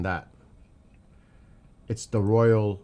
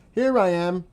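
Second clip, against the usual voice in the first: mock announcing; 8 semitones higher, 5 dB louder than usual. Mocking planes announcing themselves openly.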